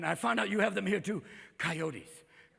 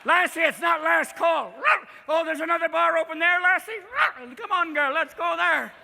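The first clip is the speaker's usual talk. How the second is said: loud, high calling out to a dog; comic high-pitched shouting; 8 semitones higher, 8 dB louder than usual. Acted. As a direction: holler eagerly for your dog. Bar drinker calls to Lassie.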